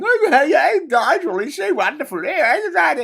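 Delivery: high, loud gushing toff parody; hammed-up shrieky mock adoration; Layla Cunningham. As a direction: simper wildly like a fawning radio presenter. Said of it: "she's on the radio going".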